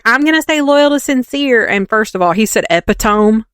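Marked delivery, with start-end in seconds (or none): 0.0-1.7 mocking her vow. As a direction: parody her swearing loyalty. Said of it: "'Cause she was like".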